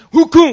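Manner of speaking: loud, high grunt of a hard elbow strike; mock grunt of effort. Pastor ramming the door with his elbow, loud grunt.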